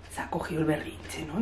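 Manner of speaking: mother quietly checking with dad about a tantrum; hushed aside. Retold or imitated. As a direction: murmur it to the husband so the child won't hear. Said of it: "yo digo".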